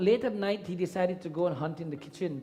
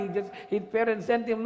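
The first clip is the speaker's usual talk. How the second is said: ordinary talk, nothing marked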